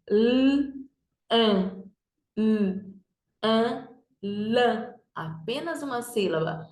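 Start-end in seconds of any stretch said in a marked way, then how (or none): 0.1-3.9 L, an as separate "lll, ã"; letter sounds. Shown as a demonstration. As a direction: hold the L, pause, then the vowel. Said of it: "fazer o sonzinho das letras". (sonzinho de cada letra: "lll, ã")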